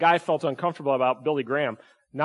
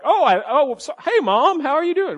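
much higher, louder hammed-up phone greeting; mock cheerful phone voice, very high; fake phone call; 11 semitones higher, 4 dB louder than usual. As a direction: overplay a seatmate faking a call to Mom. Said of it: "they're like".

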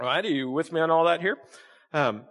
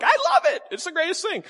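very high, sing-song gushing; mock enthusiasm. Mocking lawn lovers, squeaky high gushing. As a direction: ham up loving a chore. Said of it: "The rest of you are like".

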